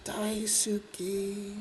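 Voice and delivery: slow, soft, whispery doll voice; imitated talking doll. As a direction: draw it out quietly like an eerie toy. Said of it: "It says".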